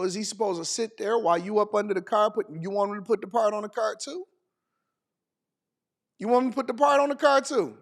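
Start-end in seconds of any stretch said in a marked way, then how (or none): 6.2-7.8 higher, quicker disbelieving question; incredulous repeat. Real, not acted. Host repeats the absurd car-part demand, incredulous. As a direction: throw the question back, pitched up in disbelief.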